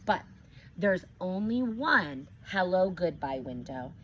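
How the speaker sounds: ordinary delivery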